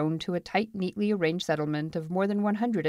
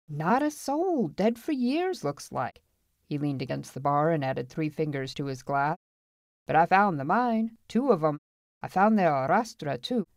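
tall-tale teller reporting his find; boastful gold finder. Acted. Feist tells townsmen of his find, boastful.

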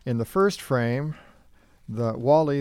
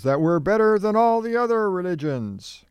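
higher-pitched, half-hearted retold yell; mock yell; 7 semitones higher, 4 dB louder than usual. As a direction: imitate a child yelling it out, raised in pitch but held back. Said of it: "And Danae yells".

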